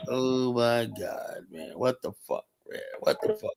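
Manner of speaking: quietly aghast, half under breath; hushed disbelief. Retold or imitated. Narrator retells his stunned reaction in the office, hushed.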